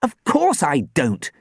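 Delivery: high, indignant retort; fierce snort. A narrator's reading. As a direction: snap back fiercely. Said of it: "Sam snorted fiercely".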